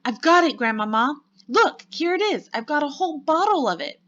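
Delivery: put-on high, loud jubilant child; excited cry. A narrator's reading. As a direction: show off the prize, bursting with excitement. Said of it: "I cried, jumping up and down".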